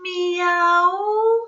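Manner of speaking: very high, long mewing cat meow. Acted. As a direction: meow like a little kitten. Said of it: "Who says meow?".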